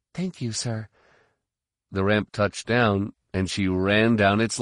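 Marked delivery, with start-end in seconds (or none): 0.1-0.9 soft grateful. A narrator's reading. Sial thanks Tycho.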